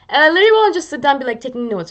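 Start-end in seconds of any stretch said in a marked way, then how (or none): none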